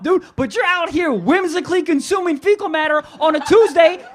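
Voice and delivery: loud, high-pitched outraged tirade; indignant rant. Acted. Defending his foot fetish to the girl, loud indignant rant. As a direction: argue back at full volume, hammed-up outrage. Ranting back in the acted quarrel.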